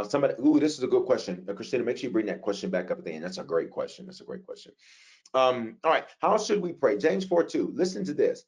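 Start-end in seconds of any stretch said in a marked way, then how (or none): 4.1-4.7 whisper. Real, not acted. Pleased preacher whispering an aside to the room. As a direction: whisper "That's a great question." to himself. Repeats the praise under his breath.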